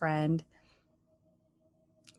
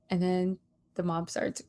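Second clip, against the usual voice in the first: normal talking voice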